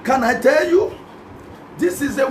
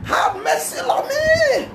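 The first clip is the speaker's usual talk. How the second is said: crying out; much slower than usual. Shown as a demonstration. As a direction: wail it like you mean it. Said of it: "you will cry, you will call Jesus".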